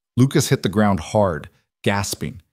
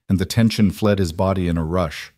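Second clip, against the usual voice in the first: usual speaking voice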